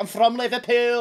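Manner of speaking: high-pitched mock Scouse accent; mimicking a singer's overdone Scouse. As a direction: put on an exaggerated Liverpool accent. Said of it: "[name] from Girls Aloud. She's like".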